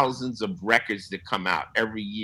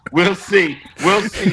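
playful mock repeat, higher and louder; teasing echo; 6 semitones higher, 5 dB louder, slower than usual. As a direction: throw his own words back at him, laughing. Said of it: "just made him smile".

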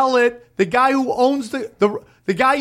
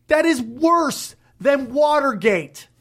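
outraged shout; 2 semitones higher than usual. Real, not acted. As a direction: hammer it home, dragging each word. Outraged punchline.

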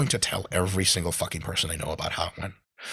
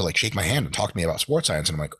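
ordinary talk, nothing marked

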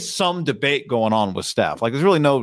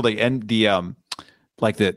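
ordinary talk

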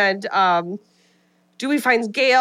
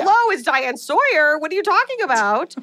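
higher, sing-song mock anchorwoman voice; mock Diane Sawyer; 7 semitones higher than usual. [name] mocking Cotton with a fake anchorwoman voice, higher and sing-song. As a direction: tease him in a put-on news voice.

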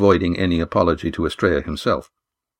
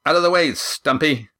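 higher-pitched, dismissive order without shouting; brusque interruption; 6 semitones higher than usual. A narrator's reading. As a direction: cut in as if nobody had spoken. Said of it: "Mirac interrupted as if Walt had not spoken".

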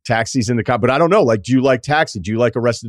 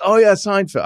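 put-on quick stab at a British accent, pitched up; Ricky Gervais impression; 10 semitones higher than usual. Gervais meeting Larry David at a party, light mock British accent. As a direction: toss off a light British accent, mimicking the comedian. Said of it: "I'm not gonna do the accent".

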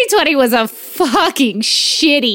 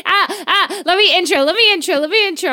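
high, eager, rushing repeats; bursting to get going; 7 semitones higher than usual. Real, not acted. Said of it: "I'm chomping at the bit".

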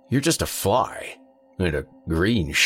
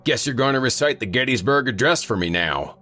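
higher, mocking sarcasm; sarcastic; 8 semitones higher than usual. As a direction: tease the fly with sarcasm. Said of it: "Sarcasm received and duly noted".

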